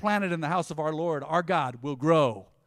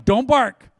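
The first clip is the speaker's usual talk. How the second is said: telling the dog off; 6 semitones higher, 10 dB louder than usual. Retold or imitated. Owner scolding the dog.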